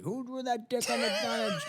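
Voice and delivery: high-pitched mock demand; retold outraged boss; company owner imitation. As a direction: call out the question high, without volume. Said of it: "the owner was like".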